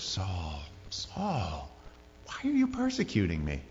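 Jesus calling to Saul, quiet and tender; gentle, sorrowful. Shown as a demonstration. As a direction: plead softly like a grieved friend. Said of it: "I think the tone of the Lord was".

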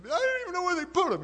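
high, loud anguished voice; distraught Mary. Retold or imitated. Mary answering the angels, high and anguished. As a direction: cry it out high as the frantic woman. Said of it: "and she says".

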